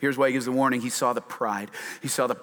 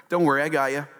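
usual speaking voice

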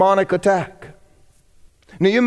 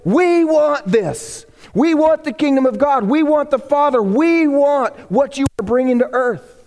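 higher, slow and longing; eager crowd; 6 semitones higher than usual. Retold or imitated. Crowd begging Jesus for the kingdom, higher and yearning. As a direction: voice a crowd crying out for more. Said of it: "to make everybody go".

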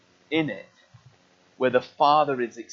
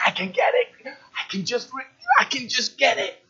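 much higher, breathy grasping voice; greedy Elsa; 8 semitones higher than usual. Retold or imitated. Preacher acting Elsa's greed, high and grasping. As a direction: strain for the cup. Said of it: "And it's like".

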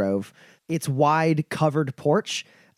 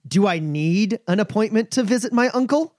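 angered shock; 7 semitones higher than usual. Acted. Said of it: "almost like in angered shock".

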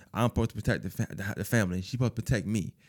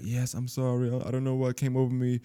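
mock remorseful. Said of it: "he acting mad remorseful".

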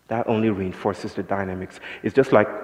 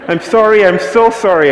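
imitating a desperate man, much higher and louder; more frantic apology; 10 semitones higher, 10 dB louder than usual. German man doubling down on his apology, desperate mock pleading. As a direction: ham up his escalating panicked sorry. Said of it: "he was shocked and was like".

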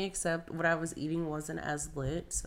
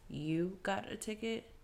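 softly incredulous, drawn-out question; slow, quiet disbelief as the question rises; retold disbelief, held back; 8 dB quieter, slower than usual. Retelling her stung disbelief.

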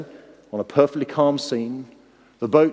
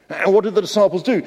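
ordinary talk

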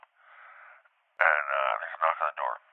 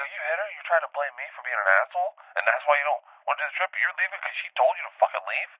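outraged yelling; 9 semitones higher, faster than usual. Retold or imitated. Host yelling at the guy who hit his girlfriend, outraged. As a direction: blast him with disbelief and fury.

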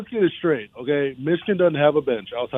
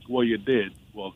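ordinary delivery

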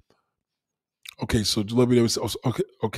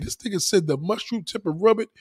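ordinary delivery

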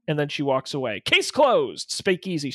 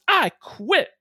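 angry outburst; 8 semitones higher than usual. Acted. Secretary quits in anger.

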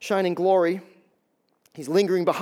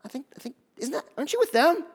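put-on servant girl; 6 semitones higher, faster than usual. Voicing servant girl squinting at Peter.

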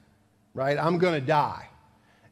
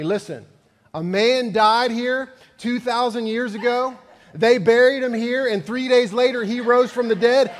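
stern punchline, higher and louder, building; 8 semitones higher, 7 dB louder than usual. Retold or imitated. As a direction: lay down the law like a stubborn son-in-law. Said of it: "And the man said very sternly".

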